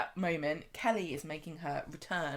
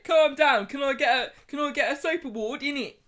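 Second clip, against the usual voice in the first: Danny Dyer impression; 6 semitones higher, 8 dB louder than usual. Cohost mocks Kevin's cockney as Danny Dyer. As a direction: ham up a cockney soap hardman. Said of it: "Kevin doing his Danny Dyer impression".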